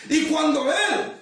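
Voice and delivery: high, driving sermon delivery; impassioned preaching. Real, not acted. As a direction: preach it with mounting urgency. Preaches story at rising force.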